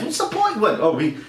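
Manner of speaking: high, sneering studio-exec parody; mocking the studio that only wants kids' money, high and sneering; mock greedy studio.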